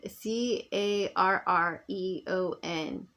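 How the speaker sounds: "C A R R E O N" spoken as separate slow letters; spelled out. Shown as a demonstration. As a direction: spell out the name slowly, letter by letter.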